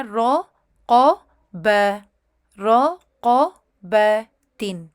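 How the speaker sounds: رو قو ب تن spoken one at a time; syllable by syllable. Shown as a demonstration. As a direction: break رقبتن into its parts. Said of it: "تو یہ heavy ہو گیا".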